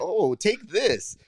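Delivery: slow, drawn-out gloating taunt; mock Magneto taunt. Mock villain gloat at Professor X. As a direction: savor every word of the taunt. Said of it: "I'm like".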